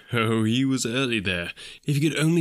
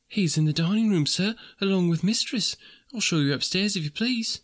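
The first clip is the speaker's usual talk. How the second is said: higher, lightly voiced housemaid; a polite young maid, higher than the narrator; 7 semitones higher than usual. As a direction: answer politely as a young serving girl. Maid shows Scrooge the way.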